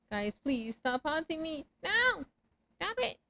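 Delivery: high panicked shrieks; being chased. Real, not acted. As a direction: shriek at them to stop. Ghosts chasing, shrieking.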